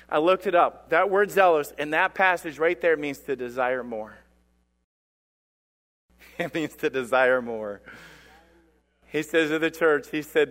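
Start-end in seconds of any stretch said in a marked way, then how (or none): none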